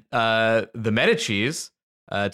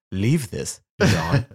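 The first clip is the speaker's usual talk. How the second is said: lightly voiced guest; 2 semitones lower, much slower than usual. Guest tells chef keep it.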